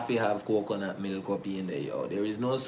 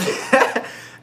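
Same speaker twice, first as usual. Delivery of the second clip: breathy giggle; 13 semitones higher, 5 dB louder than usual. Real, not acted. Laughing mid-sentence.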